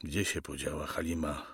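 low, soft asking; father's quiet question. Acted. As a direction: ask your son under the gunfire threat, hushed. Spytał ojciec.

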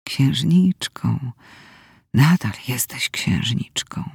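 low, soft, slow rasp; hoarse and shaky. A narrator's reading. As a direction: struggle to get the voice out. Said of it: "Chrypiał i drżał". (chrapliwie i drżąco)

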